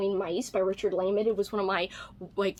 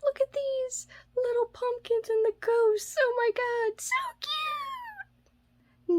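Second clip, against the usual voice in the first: much higher squeaky gush over something cute; gushing squeal; 13 semitones higher, slower than usual. Real, not acted. Showing viewers cute Halloween magnets, high gushing squeal. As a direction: coo at it like a tiny pet.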